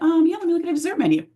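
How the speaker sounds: eager diner. Retold or imitated. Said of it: "Sometimes you're like".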